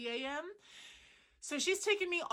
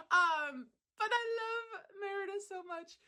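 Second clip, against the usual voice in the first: gushing; 9 semitones higher than usual. Real, not acted. Gushing after laughing.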